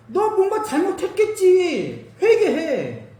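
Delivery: friends accusing. Retold or imitated.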